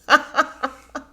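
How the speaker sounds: loud, high laughing burst; cracking up at the story; burst of laughter. Real, not acted. Vlogger laughing at the cast, loud burst.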